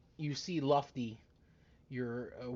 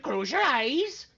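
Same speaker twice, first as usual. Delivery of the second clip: card magician patter; 8 semitones higher, 4 dB louder, slower than usual. Acted. Magician instruction.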